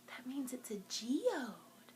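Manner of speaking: hushed, wondering whisper; awed whisper. Real, not acted. Librarian showing kids the sparkly geode, awed whisper. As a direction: whisper as if sharing a secret treasure.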